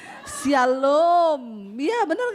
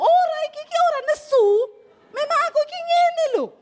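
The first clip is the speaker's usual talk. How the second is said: high, loud, exasperated denial; mock angry protest; 13 semitones higher, 5 dB louder, faster than usual. Wife loudly denying to her husband that she is angry. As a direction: insist you are not angry while clearly shouting. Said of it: "yang tadinya nggak marah jadi marah".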